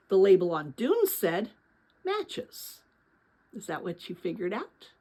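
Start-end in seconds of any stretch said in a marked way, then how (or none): 2.0-2.8 high-pitched. Acted. Reading the box label aloud.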